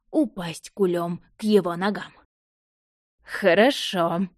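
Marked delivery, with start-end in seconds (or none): 3.2-4.3 slow, drawn-out contented hmm; satisfied drawl. A narrator's reading. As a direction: stretch it out, well fed. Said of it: "довольно протянул он".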